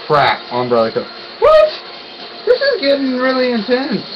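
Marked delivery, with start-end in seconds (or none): 1.4-4.0 high-pitched, alarmed squeak; startled disbelief. Real, not acted. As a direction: voice jumps way up in surprise. Startled by the game.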